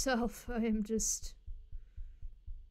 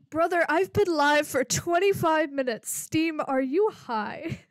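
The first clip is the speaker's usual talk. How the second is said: much louder, higher exasperation; exasperated shout; 5 semitones higher, 11 dB louder than usual. Real, not acted. Streamer yelling at Steam about playtime, loud disbelief. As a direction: yell at the app in disbelief.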